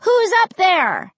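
high, stretched-out shout; shouting upstairs. A narrator's reading. Child calling to the second floor, high shout. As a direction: shout it up the stairwell. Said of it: "shouted up to the second floor".

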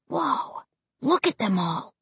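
soft, awed half-whisper; hushed whisper. A narrator's reading. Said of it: "Josh whispered".

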